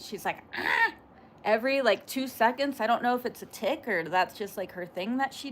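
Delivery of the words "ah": short, sudden harsh "ah"; imitated tic-like noise. Shown as a demonstration. Streamer showing the weird noise she keeps doing, abrupt yelp. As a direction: imitate an involuntary vocal tic. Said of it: "I don't know if I'm doing it right".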